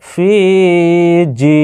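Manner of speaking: ফি and জি each held long on the vowel; drawn-out vowels. Shown as a demonstration. Teacher showing learners the madd, vowels drawn out. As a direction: stretch the long ee in ফি and জি. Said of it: "এখানে ফাকে কেন টানলাম?".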